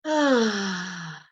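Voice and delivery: long sighing breath out with a voiced moan. Shown as a demonstration. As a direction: let the breath out on a sound. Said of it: "You could do it with sound".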